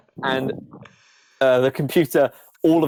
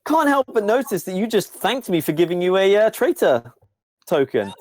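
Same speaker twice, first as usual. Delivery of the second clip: higher-pitched, pointed computer voice; computer character; 6 semitones higher than usual. Acted. Computer questions the player's thanks, pointed and high. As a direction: raise the pitch, politely calling someone out.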